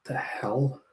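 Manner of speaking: a hushed what-the-hell at a weird name; half to yourself, a quiet confused aside; baffled whisper. Real, not acted. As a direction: whisper it under your breath, baffled.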